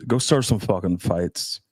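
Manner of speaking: ordinary talk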